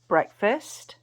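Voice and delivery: ordinary talk, nothing marked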